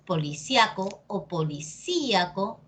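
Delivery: poli-SIA-co, then poli-SÍ-a-co; first polisíaco on A, second on Í; second polisíaco splits off a stressed Í; two accepted stresses. Shown as a demonstration. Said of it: "depende de ti cómo lo quieras decir".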